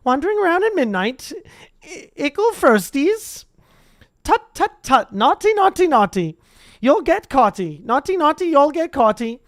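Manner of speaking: high, loud, sing-song taunting; cackling poltergeist. Acted. Peeves taunting the first-years in a high cackle. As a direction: tease them like a gleeful pest. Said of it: "Peeves cackled".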